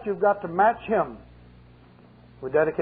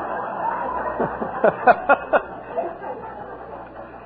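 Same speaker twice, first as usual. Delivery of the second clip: high breathy laugh; 9 semitones higher, 3 dB quieter than usual. Real, not acted. Laughing at his own joke.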